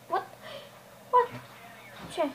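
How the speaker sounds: ordinary talk, nothing marked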